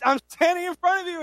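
high, loud, pointed exclamation; incredulous hinting. Acted. Jesus voiced, Mary not noticing.

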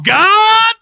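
high, stretched-out shout to God; loud, drawn-out rallying cry; rousing shout. Shown as a demonstration. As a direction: holler it slowly with full force. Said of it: "you gotta say it like this".

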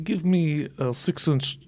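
put-on slow, hammy Russian accent; bad Russian accent. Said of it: "saying Subway sandwich things in a bad Russian accent".

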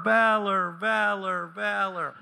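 each valor fading away like an echo. Acted. Preacher mimicking God's echo in the wine press, fading. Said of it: "There's an echo".

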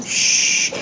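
soft breathy shush. A narrator's reading. Harry shushing the sobbing elf. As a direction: hush him gently. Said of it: "一面说“嘘".

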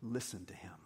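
soft, low near-whisper; hushed command. Acted. God the Father to the followers, hushed. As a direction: let it fall to a solemn hush.

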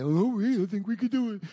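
high, loud, offhand bragging; careless boast. Acted. Preacher mocking the Israelites' careless boast, high and loud. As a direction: shrug it off like it's easy.

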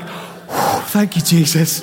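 breathy whew into a laughing thank you; relieved laugh. Retold or imitated. Pastor relieved the dream came true, laughing. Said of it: "And I'm like".

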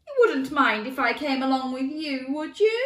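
high, anxious pleading; whimpering lion. A narrator's reading. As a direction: plead timidly as a frightened lion. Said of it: "whimpered the lion".

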